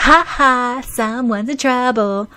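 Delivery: high, loud sing-song taunt; teasing. A narrator's reading. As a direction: gloat at a little brother. Big sister teases on phone.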